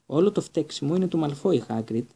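plain reading voice

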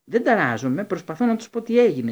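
loud exasperated protest; furious. A narrator's reading. Harry furious at the nurse, loud and exasperated. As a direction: burst out in frustration. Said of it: "είπε εξοργισμένος ο Χάρη".

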